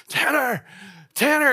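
high, carrying call into the dark woods. Retold or imitated. Leader calling a missing camper's name at night, half-shouted. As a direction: call his name, loud but held back. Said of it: "trying to be loud enough for him to hear us".

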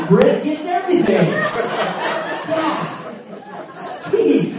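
helpless high laughter breaking into the words; mock hilarity. God laughing as Steve misses it, in mock hilarity. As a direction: hoot with laughter, squeezing out the words.

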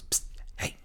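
hushed alley call, half whispered; stage whisper. Acted. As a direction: hiss it from the shadows. Shady dealer calls from alley.

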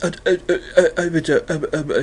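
mumbly stuttering, stuck on one syllable; muddled, mumbled false starts. Retold or imitated. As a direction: stammer as if unable to get a word out. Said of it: "he gets kind of mumbly".

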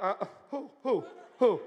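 put-on laughing stammer of an older person; mock flustered older folks. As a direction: stammer through a laugh, caught out. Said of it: "older people are like".

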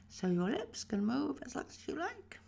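big lip movements with the teeth shut, high rising tune; exaggerated lip movement. Shown as a demonstration. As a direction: move "lips" hugely while the teeth stay closed.

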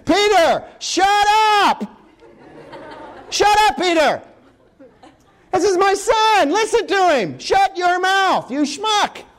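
high, hammed-up angry yell; exasperated God shouting. God cuts off babbling Peter, shouting in exasperation. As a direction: yell it in mock divine exasperation.

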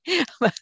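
sudden high laugh. Real, not acted. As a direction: burst out in a brief, high laugh. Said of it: "sorry, I'm laughing".

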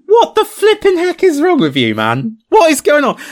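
high, loud mock outburst; mock outrage. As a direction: blow up in playful disbelief.

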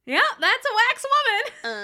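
high, loud exclaim with a laugh behind it; mock disbelief. To her co-host, mocking the wax prop in a high, loud exclaim. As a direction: call it out like you can't believe it.

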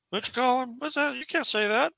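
high-pitched voice of an objector; high, questioning imitation; mock objection. Acting out a shocked objector.

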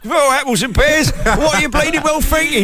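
high-pitched put-on Cockney TV character; EastEnders character voice. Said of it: "he's the character who talks like this".

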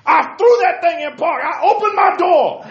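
high, loud, slow mock yelling; hammed-up TV pundit rant; Stephen A. Smith yelling. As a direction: bellow a trivial story like a sports pundit. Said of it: "he'd be yelling about it".

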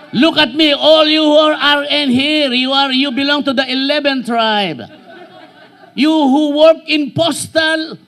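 loud, slow, emphatic sermon delivery; full-force preaching. Acted. Preaching to the congregation.

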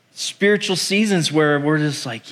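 usual speaking voice

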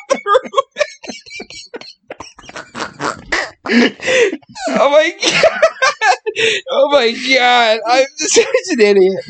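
high, squeaky words between laughs; helpless laughter. Real, not acted. Podcaster embarrassed at own roommate story, helplessly laughing. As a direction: barely get it out for laughing. Laughing fit, can barely speak.

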